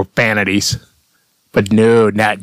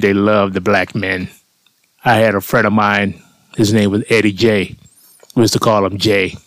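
ordinary talk, nothing marked

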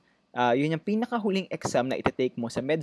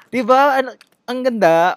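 higher, louder, delighted boast; gleeful brag; 10 semitones higher, 9 dB louder than usual. Acted. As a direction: show off, thrilled with yourself. Mock bragging about English.

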